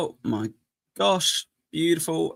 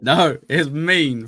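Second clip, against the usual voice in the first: mock stern protest; 4 semitones higher, 8 dB louder, much slower than usual. Insisting to co-host, mock stern.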